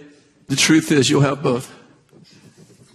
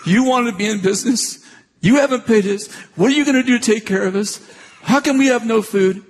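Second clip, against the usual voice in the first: higher, hammed-up nagging; mock complaint; 7 semitones higher than usual. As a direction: play the complaining spouse she never was.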